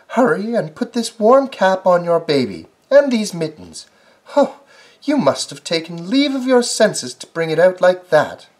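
fussing rabbit. Acted. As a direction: urge him to hurry, high. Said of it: "called Missus Rabbit".

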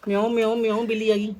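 loud, high playful meowing; dramatic loud aunty. Acted. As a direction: meow loudly at the little one. Playing with daughter after school.

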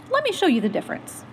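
ordinary talk, nothing marked

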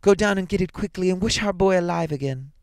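high, loud, urgent exultation; elated old woman urging her husband on; triumphant. A narrator's reading. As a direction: command it with mounting, high-pitched triumph. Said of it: "she cried triumphantly".

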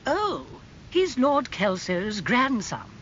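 high "oh", then slow recognition; knowing aristocratic aunt. A narrator's reading. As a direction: place the family in a high aunt's voice.

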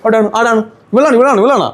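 high, babyish pretend indignation; mock scolding. Uncle blaming someone for making his nephew cry, mock-scolding baby talk. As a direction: pretend to hunt the culprit for the toddler. Mock blame to comfort the child.

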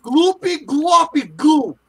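high, slow shouted nonsense; silly shout. Pretend. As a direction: belt the nonsense words.

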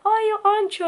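high, eager fan recognising her; high starstruck fan voice; excited fan. Retold or imitated. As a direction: mimic a thrilled fan spotting a vlogger. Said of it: "and you'll be like".

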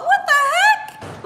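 very high, drawn-out incredulous squeal; retold disbelief. Wife retelling her reaction to the pork chop remark, incredulous. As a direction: squeal it slowly, in mock outrage.

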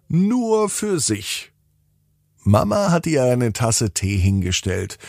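ordinary delivery, nothing marked

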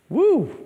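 high, loud "woo"; whoop. Real, not acted. Preacher reacting to a father's hurtful words, high whoop. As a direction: let out a high exclaiming whoop.

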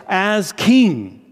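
normal talking voice

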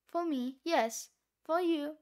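ordinary talk

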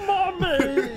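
high wailing cry for mommy; whiny child voice. Pretend. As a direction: wail like a hurt little kid.

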